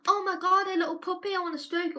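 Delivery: put-on high, gushing little-kid squeal; excited toddler. Toddler thrilled at a puppy, high excited kid voice. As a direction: burst out like a child seeing a puppy. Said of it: "all they think in their head is".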